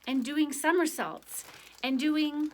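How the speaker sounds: rising excited list; building excitement. Acted. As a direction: build the list up to a big finish.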